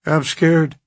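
terrified. A narrator's reading. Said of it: "Burton was terrified".

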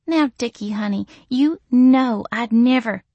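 high sugary denial; cooing Missy. A narrator's reading. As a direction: sweet-talk him innocently. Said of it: "she cooed".

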